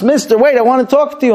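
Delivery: high, urgent mock call; calling after someone. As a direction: call out to stop someone leaving. Calls after the knight.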